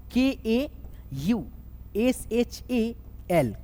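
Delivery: letter by letter, drawn out; slow spelling. Shown as a demonstration. Teacher spelling a word slowly for students. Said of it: "तो कैसे लिखना है?".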